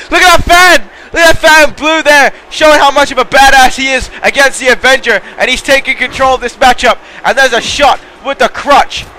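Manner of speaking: rising commentator hype; hyped call. Real, not acted. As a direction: sell the big spot to the crowd. Big high-risk spot, hyped call.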